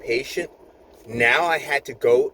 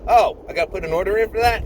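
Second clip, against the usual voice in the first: higher, quick, surprised doctor; mock surprise; 9 semitones higher, 5 dB louder, faster than usual. Doctor surprised he must enter the order, fast and higher. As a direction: rattle it off, caught off guard.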